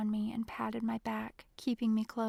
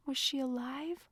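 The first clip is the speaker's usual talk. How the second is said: choked whisper; 3 semitones higher than usual. A narrator's reading. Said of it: "in a choked whisper".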